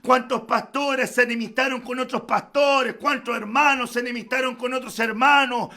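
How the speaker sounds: loud, rising pulpit preaching; preaching at full force. Acted. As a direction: drive the congregation toward the climax.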